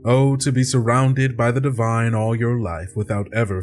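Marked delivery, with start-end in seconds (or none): none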